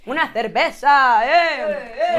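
loud, drawn-out parody of a Spaniard; mock Spanish accent. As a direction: bellow it slowly in a hammed-up Castilian accent. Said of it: "estamos imitando".

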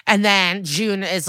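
usual speaking voice

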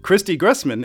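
ordinary talk, nothing marked

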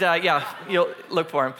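normal talking voice

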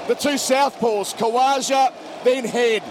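rising live call, excited at wickets; cricket commentator. Real, not acted. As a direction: call each ball as it happens.

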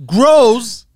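normal talking voice

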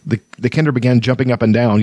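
ordinary delivery, nothing marked

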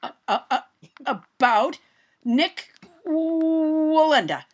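slow halting speech, balancing. Pretend. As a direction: drag each word out carefully. Pretend balancing on tightrope.

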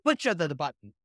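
"Pusha the, the button" in a hammed-up cartoon Italian plumber voice; mock Mario voice. Cohost riffing on the Mario joke, mock Mario voice. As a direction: goof on it like a video game plumber. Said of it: "all of this being done by Chris Pratt as Mario".

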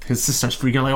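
ordinary talk, nothing marked

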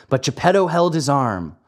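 plain reading voice

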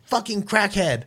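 high, loud mock rant from the customer; mocking angry rant. Rude shopper accusing coworker, high mock rant. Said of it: "and she goes".

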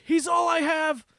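high, pleading wail; pleading cry. Retold or imitated. Boy begging the gunman to spare his friend, high wail. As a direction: beg for your friend's life. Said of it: "He said something like".

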